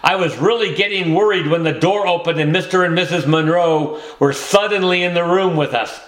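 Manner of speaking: usual speaking voice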